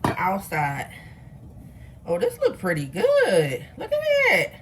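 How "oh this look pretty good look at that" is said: delighted squeal. Real, not acted. Delighted by the cut cake.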